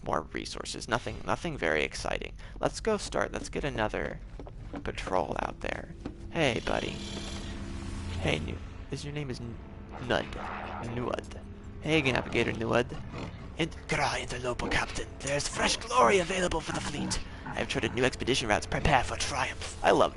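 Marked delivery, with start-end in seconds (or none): none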